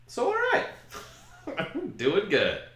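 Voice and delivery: ironic cheer. Real, not acted. Storyteller ironically cheering his own awkward moves, high and sarcastic. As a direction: pat yourself on the back mockingly. Mock self-congratulation on failures.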